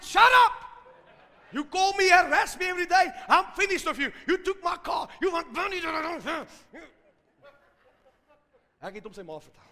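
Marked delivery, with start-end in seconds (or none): 0.0-0.5 very loud, high cut-off yell; explosive acted outburst; angry shout. Retold cutting off the caller. 1.5-6.7 loud, high shouted tirade; acted furious outburst; angry rant. Retold rant at debt collector.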